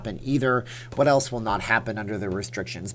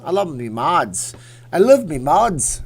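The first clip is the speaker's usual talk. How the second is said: bad English accent; 4 semitones higher, 6 dB louder than usual. Streamer praising the chat mods in a bad English accent. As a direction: put on a hammy English accent. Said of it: "talking like a bad English accent".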